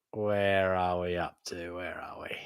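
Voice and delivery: a lost little whisper to yourself; whispered to himself. Real, not acted. Host checks his notes, whispering to himself. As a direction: whisper it under your breath.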